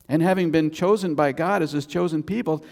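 ordinary talk, nothing marked

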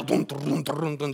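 low, soft rumbling drum-roll of "duns"; imitated procession rumble. Preacher mimics Naaman's horses and chariots riding down, low imitated rumble. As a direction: mimic horses and chariots rolling along under your breath.

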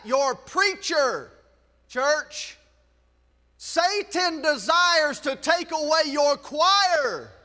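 rising, emphatic sermon litany; preacher pressing the repeated warning; preaching at full force. Acted. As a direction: drive each line harder toward the congregation.

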